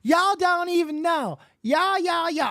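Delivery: high, slow exaggerated Southern drawl on y'all; mock Southern accent. Podcast host mocking [name]'s voice, slow high Southern drawl. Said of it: "She has a Southern drawl".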